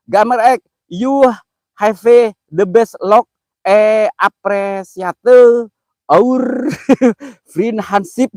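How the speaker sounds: struggling through English on stream; halting English reading. Shown as a demonstration. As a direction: sound out the English comment slowly. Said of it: "Gue bingung ngomongnya kalau bahasa Inggris".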